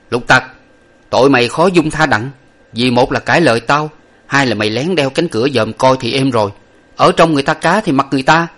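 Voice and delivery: a hint of an indignant master; stern master scolding. A narrator's reading. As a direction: scold the servant, listing his offences.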